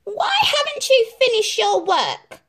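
very high, loud demand; demanding stepmother. A narrator's reading. Stepmother demands Cinderella explain, high loud voice. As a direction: demand it coldly. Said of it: "demanded the stepmother".

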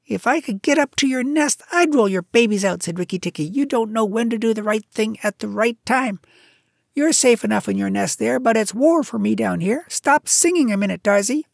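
urgent, fed-up mongoose; Rikki Tikki. A narrator's reading. Mongoose tells the bird to stop singing, impatient.

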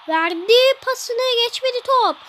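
high, loud goal call, stretched out; screaming football commentator. Acted. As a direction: celebrate the goal on air.

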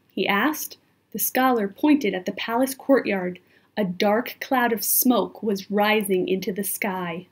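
usual speaking voice

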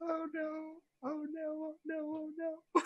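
tiny high, soft moaning; mock lament. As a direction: whimper it small and high, playfully. Player laments outcome, repeatedly.

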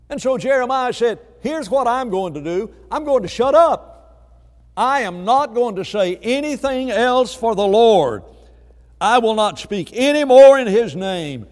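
emphatic, defiant preaching; impassioned preaching. Retold or imitated. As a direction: declare the refusal with full pulpit force.

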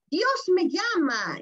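slow, high exclamation. Acted. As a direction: draw it out as the angel's joyful cry. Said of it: "esos signos de admiración".

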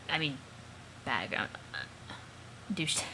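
nervous stammer over the word; stuttering. Acted. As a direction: trip over the words nervously. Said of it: "I, I'm stuttering".

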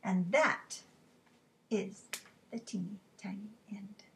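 soft, winding-down storybook ending; story narration. A narrator's reading. Closes the story softly.

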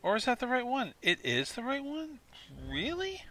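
high-pitched disbelieving questions; incredulous. Real, not acted. Player finding it was the right one, incredulous high voice. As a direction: pitch jumps way up in surprise.

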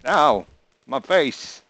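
loud, high "ow, my face"; mock pained yelp. Real, not acted. Hit in game.

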